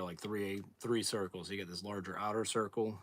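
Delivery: ordinary delivery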